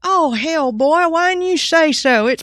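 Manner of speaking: loud, high, slow hammed-up Southern drawl; vernacular Southern directions. Clerk giving backroad directions, hammed-up Southern vernacular. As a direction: holler it like a backwoods Southern local. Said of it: "it was almost like that".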